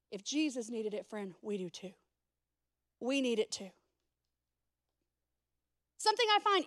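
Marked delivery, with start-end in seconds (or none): 0.1-3.7 hushed. Real, not acted. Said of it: "intimate appeal to congregation".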